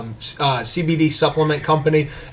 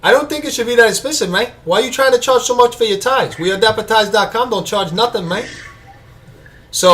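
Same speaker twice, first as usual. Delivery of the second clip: fast, high mock Cuban gangster accent; Tony Montana accent; 6 semitones higher than usual. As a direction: scold the pricey tie sellers like a Cuban gangster, in a mock Cuban accent. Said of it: "Dave suggested Tony Montana".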